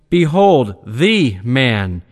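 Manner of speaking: "the" said long as "thee", set apart after a firm "Behold"; stress on "the". Acted. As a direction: lean hard on "the", pronounced thee. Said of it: "with an emphasis on the words".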